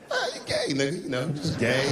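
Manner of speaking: mumbling. Retold or imitated. Comic asked what he thinks by his buddy, mumbling. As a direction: mumble vaguely, dodging a real answer. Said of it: "And I started mumbling again".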